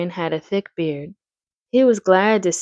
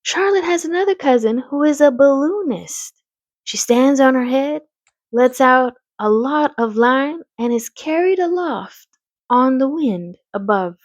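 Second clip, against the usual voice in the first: a hint of an enthusiastic girl, pitched up; lightly voiced eager daughter; 6 semitones higher than usual. Fern telling her mother about a balloonist cousin, lightly enthusiastic.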